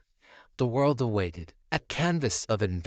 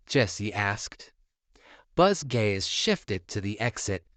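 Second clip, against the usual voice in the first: usual speaking voice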